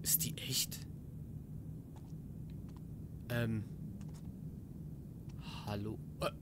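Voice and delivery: hushed whisper to himself. Real, not acted. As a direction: whisper it warily, eyeing the doll. Spooky puppet scene. (geflüstert, misstrauisch)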